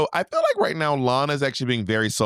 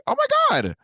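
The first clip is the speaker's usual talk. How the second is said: very high-pitched mock amazement; mock gushing. Host imagines someone reacting to the book, mock gushing. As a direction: squeal it as an imagined impressed onlooker.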